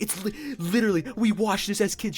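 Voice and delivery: high, giddy disbelief; mock starstruck excitement. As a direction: squeal it like a starstruck fan. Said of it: "She was freaking the fuck out".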